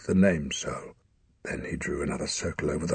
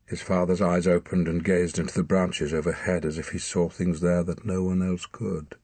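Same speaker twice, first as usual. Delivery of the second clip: ordinary delivery, nothing marked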